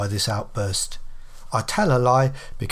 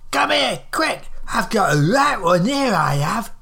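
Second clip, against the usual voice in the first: put-on high, urgent old woman's call; hollering; 6 semitones higher, 3 dB louder than usual. A narrator's reading. Old woman summons her friend to see the man, hollering. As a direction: summon a friend in a raised, indignant voice. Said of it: "she hollered".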